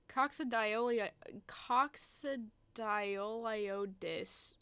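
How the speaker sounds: very slow, drawn-out attempt at "coccidioliotis"; sounding out. Real, not acted. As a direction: stretch each syllable while working out the word. Struggling to sound out the word.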